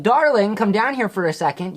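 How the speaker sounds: sweet calling out. Retold or imitated. Acting out a nicer way to summon someone, sweet calling. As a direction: call a loved one downstairs, playfully. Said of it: "just be like".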